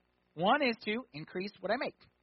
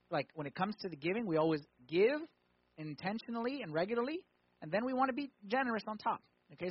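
ordinary talk

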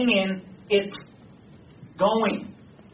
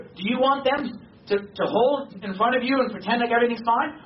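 ordinary delivery

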